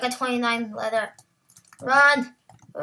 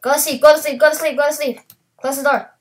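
urgent shouting; 4 semitones higher, 7 dB louder, faster than usual. Real, not acted. Urging partner to bed, night.

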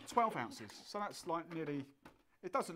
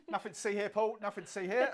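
laughing; 4 semitones higher, 7 dB louder than usual. Real, not acted. Colleague sneaks off with a drink.

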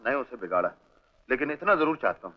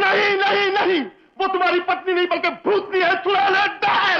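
very high, loud denial and abuse; full-throated angry tirade, voice pitched way up; furious shouting; 3 dB louder than usual. Acted. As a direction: scream back in outrage. Shouts down friend, curses fiancée.

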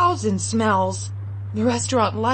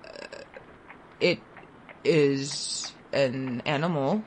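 awkward father; 8 semitones lower, 5 dB quieter than usual. Acted. Father answers child awkwardly.

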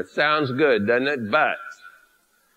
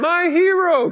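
very high, louder swooning gasp; swooning damsel; 13 semitones higher, 4 dB louder, slower than usual. Pretend. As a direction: gush like a rescued maiden. Heroine swoons at her rescuer.

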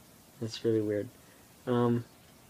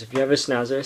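normal talking voice

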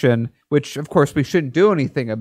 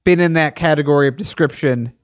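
ordinary delivery

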